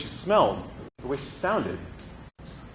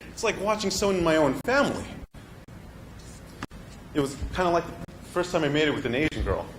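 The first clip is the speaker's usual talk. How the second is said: ordinary talk, nothing marked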